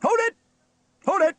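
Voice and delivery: high, very loud halting shout. A narrator's reading. Steele halts his laughing crew, shouting. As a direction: shout twice to stop the band. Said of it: "shouted Steele".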